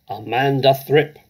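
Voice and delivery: put-on loud bark of a child's name across the playground; shouting a name. Headmistress calling a pupil across the playground, loud shout. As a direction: call her out, loud and harsh. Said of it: "she was shouting".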